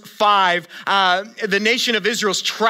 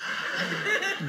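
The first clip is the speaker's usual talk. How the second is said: delighted gasp; 12 semitones higher, 10 dB quieter than usual. Retold or imitated. Congregation reacting to baby picture.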